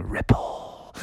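soft voiceless whisper; whispered effect. Acted. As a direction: whisper it like a tiny echo. Water rippling between footsteps.

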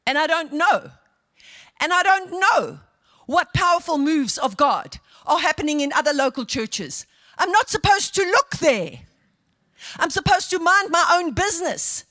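emphatic, exhorting pulpit voice; forceful preaching. Real, not acted. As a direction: press the congregation with insistent questions.

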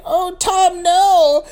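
high, loud wailed plea; hammed-up cry of dismay; mock distressed wife. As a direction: plead in a put-on high voice. Acts wife pleading with husband.

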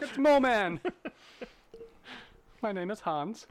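high, loud goofy voice breaking into laughter; silly high voice. Pretend. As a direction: ham up a silly spaceman. Pretend voice on the tin phone.

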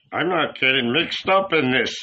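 lightly voiced reluctant old man; reluctant Philip. A narrator's reading. Philip refusing to get involved, reluctant character voice. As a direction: back out of the quarrel.

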